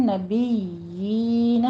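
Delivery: نبیین with the یا long for two counts; slow careful نبیین; drawn out. Shown as a demonstration. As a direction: stretch the یا in نبیین slowly. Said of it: "اس میں بھی آپ نے دھیان رکھنا ہے،".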